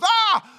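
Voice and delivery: high, loud startled shriek; mock frightened yelp. Mary startled by the angel, high mock shriek. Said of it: "she's afraid".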